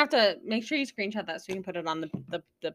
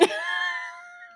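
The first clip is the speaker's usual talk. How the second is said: laughing; 4 dB quieter than usual. Real, not acted. Cracking up at the picture.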